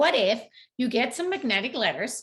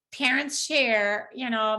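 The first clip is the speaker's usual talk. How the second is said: usual speaking voice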